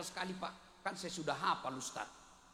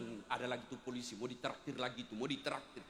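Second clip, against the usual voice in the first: lightly voiced sneering onlookers, much lower and softer; mocking gossip; 10 semitones lower, 7 dB quieter than usual. Café customers sneer at the arriving policeman, low and soft. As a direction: murmur it low like scornful gossip.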